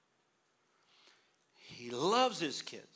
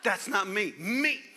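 much louder, higher laughing demand; loud exasperated parent; stern parent shout; 8 semitones higher, 6 dB louder than usual. Pretend. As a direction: shout at the kid to look. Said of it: "I said".

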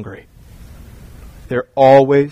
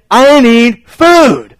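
high, loud, slow drawn-out whine; whiny kid; 9 semitones higher, 8 dB louder, much slower than usual. Retold or imitated. Son begging for food after dinner. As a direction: stretch it like a starving child. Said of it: "they will say".

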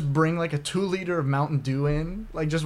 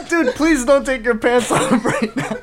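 high, loud, slow plea with laughter; mock begging; 7 semitones higher, 8 dB louder than usual. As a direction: beg in hammed-up alarm. Plays moviegoer begging fan to stop.

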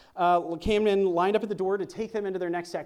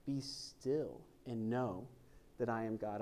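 slow hushed reading; 7 semitones lower, 12 dB quieter, slower than usual. Shown as a demonstration. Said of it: "actually means to let go".

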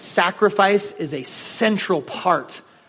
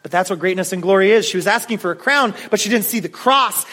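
ordinary talk, nothing marked

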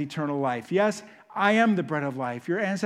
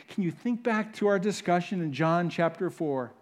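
usual speaking voice

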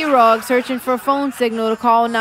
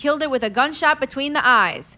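ordinary talk, nothing marked

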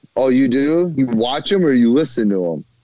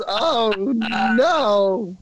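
high, squeaky dismay; 12 semitones higher than usual. Real, not acted. Reacting to leg scratching.

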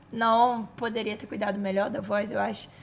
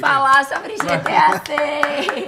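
announcer voice; 4 semitones higher, 10 dB louder, much slower than usual. Acted. Loud slow announcing of topic.

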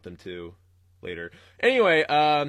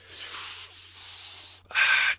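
exasperated sigh. Real, not acted. Annoyed sigh at customer.

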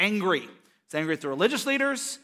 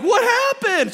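high, loud panicked cry, imitating wife; hammed-up high-pitched alarm; alarmed shriek; 8 dB louder, slower than usual. Wife reacts to hospital news.

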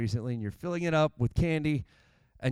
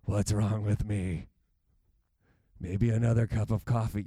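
worn-out self; 7 semitones lower than usual. Retold or imitated. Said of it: "we still think".

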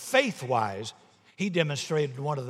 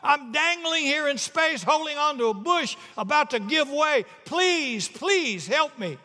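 high, loud, desperate pleading; frantic scream; 11 semitones higher, 4 dB louder than usual. Acted. Man dangling from cliff begging the Lord, screaming. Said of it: "The man screamed".

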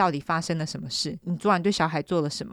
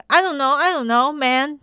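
mock flustered protest; 6 semitones higher than usual. Imitates suspect's flustered English answer.

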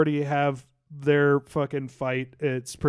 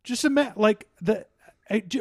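normal talking voice

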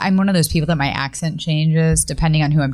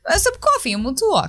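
coffee and talk in a Long Island accent; 12 semitones higher than usual. Shown as a demonstration. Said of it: "But do your Long Island accent".

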